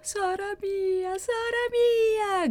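very high, long-drawn wail; crying out. A narrator's reading. Maddalena as her sister stops breathing, crying out. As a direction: stretch each cry out high in anguish. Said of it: "gridò Maddalena".

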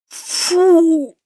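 high, loud "fu" of disgust. Acted. Chebantseva recoils from the stench, high and loud. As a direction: recoil from the stink.

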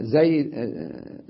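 usual speaking voice

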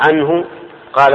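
ordinary talk

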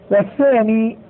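ordinary talk

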